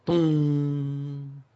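a spoken bell toll "咚"; bell sound. Retold or imitated. Imitating the temple bell. (模仿钟声"咚")

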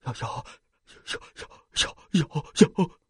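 stuttering in fright, rising on the last words; shaking so hard the words won't come out; terrified stammering; trembling stammer. A narrator's reading. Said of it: "厉正一哆嗦着说".

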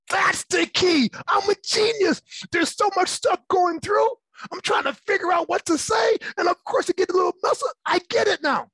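giddy shouted epiphany; excited laughing rush. Real, not acted. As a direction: burst out with it, laughing at your own discovery. Excited about his breakthrough.

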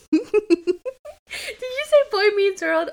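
high, slow laughing question; laughing disbelief. Real, not acted.

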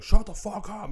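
high, loud full-throated yell; screaming match. Retold or imitated. Man in a screaming match with a dog, high loud scream. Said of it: "got into a screaming match with a dog".

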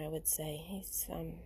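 real whisper so the dog sleeps on; whispering beside a dying, sleeping dog. Real, not acted. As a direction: hush so as not to wake the snoring dog. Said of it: "my dog is in the background snoring".

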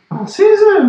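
high, loud silly mock voice; mock high-pitched call; mock squeaky voice. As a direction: squeak it out like a joke imitation. Mocking Manchester voice.

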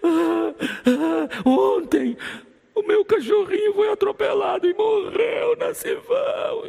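wailing sobs. Retold or imitated. Brother wailing to the pastor about his dead dog, high crying shout. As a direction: wail it through tears. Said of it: "Ele disse".

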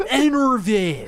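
put-on laughing West Coast accent. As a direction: say it like a laughing surfer. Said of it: "In a West Coast accent?".

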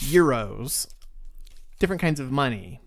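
normal talking voice

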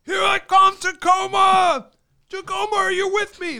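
high, loud stadium rock yell; [name] shout. Retold or imitated. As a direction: shout to the arena crowd, slowly. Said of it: "and he's like".

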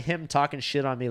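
ordinary talk

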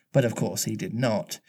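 usual speaking voice

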